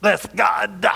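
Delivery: mock hellfire preacher shouting Bless God; preacher's cry. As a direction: lightly imitate a fiery old preacher. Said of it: "he preached what they call hellfire and brimstone".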